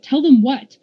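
mildly exasperated question; demanding. A narrator's reading. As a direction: demand an explanation. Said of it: "Polly M demanded".

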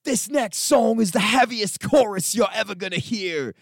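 high, loud mock rock-frontman boast; singer's hype. Retelling the singer's stage boast, mock rocker hype. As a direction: ham up the cocky singer. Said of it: "'cause he's like".

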